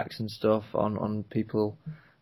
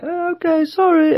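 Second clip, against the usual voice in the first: very high-pitched little mock apology; tiny high voice imitating her; imitated sheepish apology; 6 dB louder than usual. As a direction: squeak out a retold sorry. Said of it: "and she was like".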